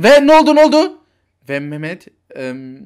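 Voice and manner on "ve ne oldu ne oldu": loud, high, anxious questioning; frantic. Acted. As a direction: burst out desperate for news. Frantic father awaiting news. (telaşla bağırarak)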